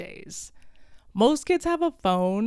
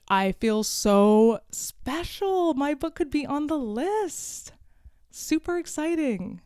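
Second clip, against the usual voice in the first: sarcastically gushing, stretched then higher; mock excitement; 6 semitones higher than usual. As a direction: gush as if it were a huge honor. Mock excitement, then "actually not.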